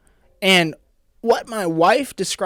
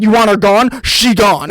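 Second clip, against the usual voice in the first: tough bridesmaid; 3 semitones higher, 8 dB louder, slower than usual. Retold or imitated. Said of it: "bridesmaids went".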